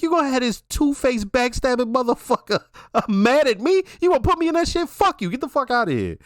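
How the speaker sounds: high-pitched mock indignation; worked-up retelling; indignant quote. Retells an angry character's refusal.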